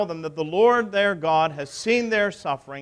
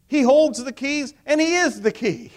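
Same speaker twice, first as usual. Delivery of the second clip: loud climactic declaration; 7 semitones higher, 8 dB louder than usual. Real, not acted.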